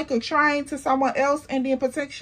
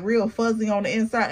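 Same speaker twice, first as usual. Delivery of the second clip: usual speaking voice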